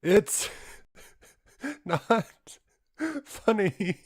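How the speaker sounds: high-pitched words broken by laughter; squeaky, laugh-choked "not funny"; giggling through it. Retold or imitated. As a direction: try to be serious but keep cracking up. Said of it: "Between giggles, all I can blurt out is".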